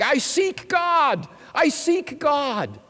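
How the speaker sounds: slow, high, loud anguished cry. Acted. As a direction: cry it out to the marketplace.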